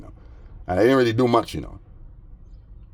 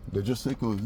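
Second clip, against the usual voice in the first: normal talking voice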